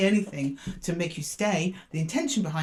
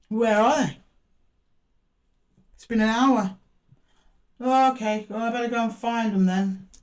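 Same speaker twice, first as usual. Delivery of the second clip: raised, louder mock impatience; mock put-out; 3 semitones higher, 4 dB louder than usual. Boss waiting an hour for breakfast, mock put-out. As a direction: play the kept-waiting boss, voice lifted. Play-acting waiting for an absent servant.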